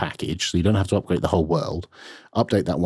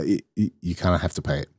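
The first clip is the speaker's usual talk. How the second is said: ordinary delivery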